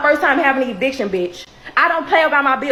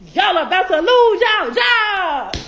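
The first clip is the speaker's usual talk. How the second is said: shouted threat; 5 semitones higher, 3 dB louder, slower than usual. Acted. Shouting the threat at office staff.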